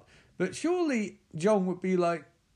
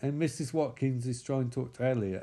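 normal talking voice

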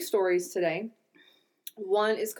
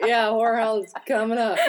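giggling imitation of cohost; laughing through a mock quote; laughing mimic; 4 dB louder than usual. Mocking her cohost's blank answer, laughing. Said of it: "She's like".

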